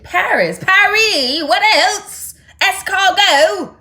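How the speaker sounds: high, loud mock French accent; dubious Paris accent. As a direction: belt it out in a bad Parisian accent. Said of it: "I don't think that's a Paris accent".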